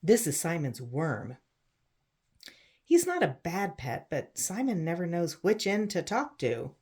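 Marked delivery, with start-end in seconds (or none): none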